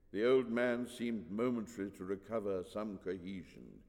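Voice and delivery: plain reading voice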